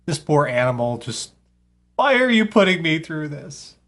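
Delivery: ordinary delivery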